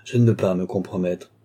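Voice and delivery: ordinary delivery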